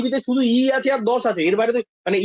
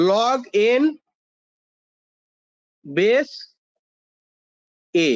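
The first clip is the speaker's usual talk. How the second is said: word-by-word, long pauses between words; slow, spaced-out dictation for note-taking; much slower than usual. Shown as a demonstration. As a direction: dictate "log ... n ... base ... a" with big gaps. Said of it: "লিখে নাও এটা".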